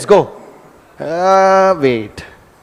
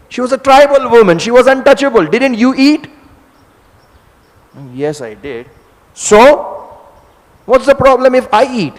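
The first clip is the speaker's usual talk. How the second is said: louder, higher challenging questions; wife challenging her husband, a touch raised; pointed retort; 5 semitones higher, 5 dB louder than usual. Acted. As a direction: turn his own past against him. Lakshmi argues back.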